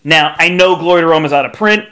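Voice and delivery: ordinary talk, nothing marked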